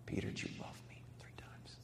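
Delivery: a soft breath of a whisper; Jesus whispering his question to Peter. Acted. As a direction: whisper it, tender and searching. Jesus asks a third time.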